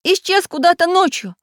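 high, anxious voice; worried tin man. Acted. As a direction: fret aloud. Samodelkin worried.

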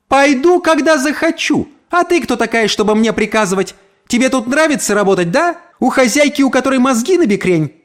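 very high, scornful snapping back; defiant retort. A narrator's reading. Neighbour defies the maid's order, defiant retort. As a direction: refuse with open contempt.

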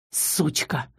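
low, soft growl. A narrator's reading. Count cursing the mouse, low soft growl. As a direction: growl it under your breath, low. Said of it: "рыкнул граф".

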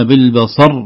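بالبصر with a loud push on the last syllable, as if r were doubled; pushed final ra. Shown as a demonstration. Teacher demonstrating the error to avoid, pushed ending on بالبصر. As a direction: punch the end of بالبصر into a heavy r. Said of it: "نحذر من الدفع بنبرة في الراء".

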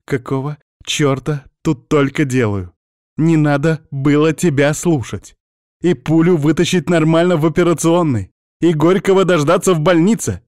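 high, loud agitated tirade, building; mounting hysteria. Acted. Witch regrets listening to the wounded man, high loud hysterical rant. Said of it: "Завибрировал её голос от начинавшейся истерики".

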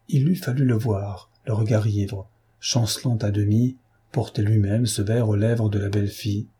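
ordinary delivery

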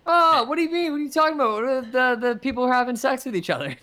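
mock innocent. Teasing feigned innocence.

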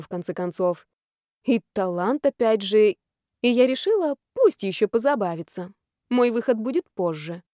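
ordinary delivery, nothing marked

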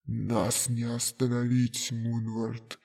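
low, slow voice echoing in the mind; a dying voice echoing inside his head; distant flashback echo. Acted. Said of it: "эхом прозвучало в голове". (далёкое эхо в голове)

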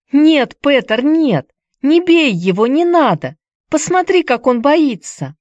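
put-on high, loud girl's plea to stop; loud pleading cry. A narrator's reading. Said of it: "Хайди громко закричала". (громкий умоляющий крик девочки)

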